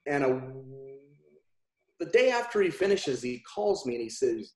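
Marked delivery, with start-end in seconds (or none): none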